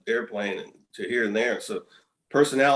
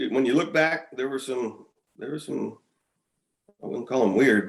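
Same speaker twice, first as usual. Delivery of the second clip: normal talking voice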